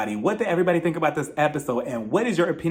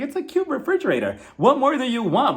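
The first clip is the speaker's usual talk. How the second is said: higher-pitched incredulous retort; mock exasperation; 7 semitones higher than usual. Viewer answering the woman ashamed of her cute refrigerator, mock exasperation. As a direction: toss it back as a baffled comeback.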